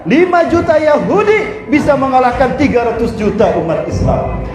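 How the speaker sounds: usual speaking voice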